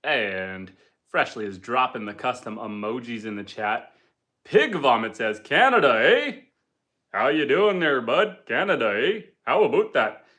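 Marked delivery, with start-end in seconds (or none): 5.4-10.2 hammed-up Canadian eh and bud; mock Canadian hoser voice; Canadian accent. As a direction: play up the Canadian twang for the chat. Reading viewer's Canadian joke.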